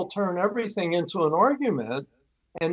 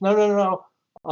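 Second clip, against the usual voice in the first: higher, louder retold protest; imitated alarmed retreat, pitched up; hasty backpedal; 6 semitones higher, 5 dB louder than usual. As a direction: act out a caller hurriedly backing down. Caller backs down at hang-up threat.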